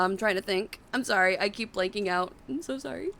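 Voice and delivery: ordinary talk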